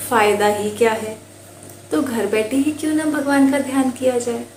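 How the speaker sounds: ordinary delivery, nothing marked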